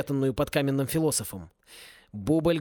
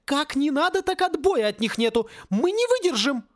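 high-pitched panicked protest; desperate cry; 11 semitones higher than usual. A narrator's reading. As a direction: cry out high, as if about to be overrun. Besieged character cries for help.

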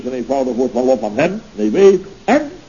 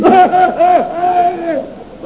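loud high laugh; 5 dB louder than usual. Acted. Chef laughs at a jibe.

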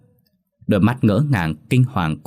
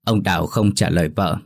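ordinary delivery, nothing marked

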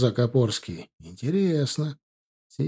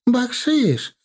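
much higher eager "бакшиш!"; fawning cry; 12 semitones higher than usual. Acted. Merchant pushes a bribe on the officer, high eager cry. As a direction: fling out the offer.

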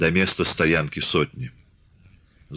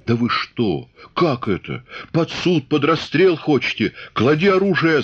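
yelling; 7 semitones higher than usual. A narrator's reading. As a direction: roar the order to drop the rifle. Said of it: "заорал урядник". (урядник орёт, разнимая казаков)

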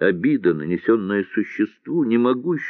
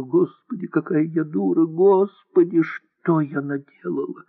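tearful whispered plea; 8 semitones higher than usual. A narrator's reading. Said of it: "пухлыми детскими губами шептала".

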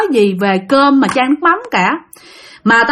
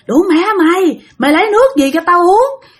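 high, sharp cursing outburst; a possessed grandma lashing out at her son; bà chửi giận dữ, giọng cao; angry cursing grandma; 6 semitones higher than usual. Acted. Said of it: "Chửi quá trời chửi luôn".

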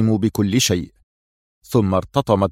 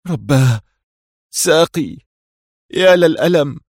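higher-pitched cry of pain; pained outcry; 5 semitones higher, 3 dB louder than usual. A narrator's reading. Sherif hurt in the crash, crying out in pain. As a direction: cry out over a hurt leg. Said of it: "سمعت شريف يسعل ويهتف".

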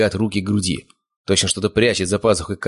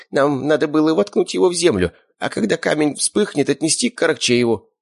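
high, weak-man's voice, anxious; nervous captive confessing; scrawny frightened captive; 9 semitones higher than usual. Acted. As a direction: explain yourself in a thin high voice.

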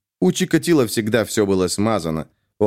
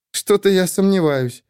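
dry, skeptical smirk, pitched higher; a light smirk that shrugs him off; smirking doubt; 9 semitones higher than usual. A narrator's reading. Colleague brushing off his idealism, smirking. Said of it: "усмехнулась Овсянникова".

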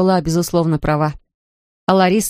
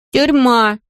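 put-on gloomy little boy; 6 semitones higher, 4 dB louder than usual. A narrator's reading. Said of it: "кивнул Патрик".

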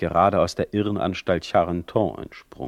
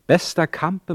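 high, loud mock-sweet greeting; butter him up theatrically; exaggerated fawning address; ironic flattery; 10 semitones higher, 5 dB louder than usual.

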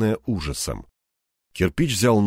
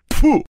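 high, loud contemptuous spit; spitting "pfu"; 6 dB louder than usual. A narrator's reading. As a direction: spit out a puff of disgust. Презрительно плюёт на пленника. (презрительный плевок)

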